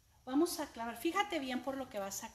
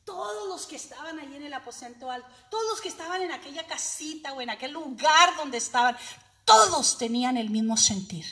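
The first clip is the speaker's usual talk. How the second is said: louder, driving narration of the upper room; preaching swell; 4 semitones higher, 16 dB louder than usual. Acted. Preacher telling the church of the upper room, swelling louder. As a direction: build like a revival sermon.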